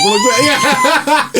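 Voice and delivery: high, sharp mock reprimand; scolding shush. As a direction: snap at someone to behave. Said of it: "Chad Veach's wife went like this".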